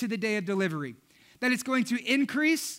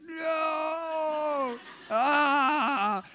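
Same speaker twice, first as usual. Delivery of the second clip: horrified no; 5 semitones higher than usual. Retold or imitated. Husband watching the birth.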